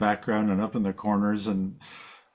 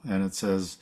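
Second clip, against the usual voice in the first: ordinary talk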